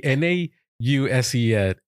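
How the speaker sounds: ordinary talk, nothing marked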